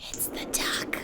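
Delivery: eerie hushed whisper. Pretend. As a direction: whisper it like a ghost story. Spooky duck warning.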